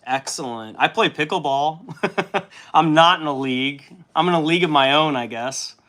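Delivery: normal talking voice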